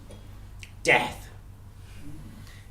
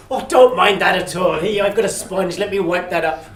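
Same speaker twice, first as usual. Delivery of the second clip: louder, eager reassurance; bustling host brushing it off; fussy host; 4 semitones higher, 8 dB louder than usual. Acted. Hamish wiping up Death's whiskey, eager and loud.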